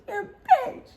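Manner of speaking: very high-pitched whiny whimper; squeaky mock whine, not quite crying; mock whining. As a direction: imitate a sulky drunk whine. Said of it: "just, like, whining".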